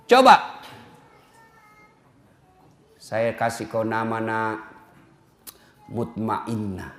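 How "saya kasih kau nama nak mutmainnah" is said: much softer, lower, slow naming; gentle naming of a newborn; tender father. Acted. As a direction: whisper the name like a blessing.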